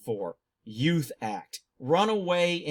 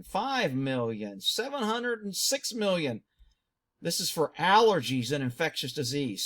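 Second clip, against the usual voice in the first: ordinary talk, nothing marked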